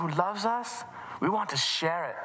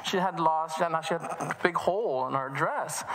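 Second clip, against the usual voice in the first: ordinary delivery, nothing marked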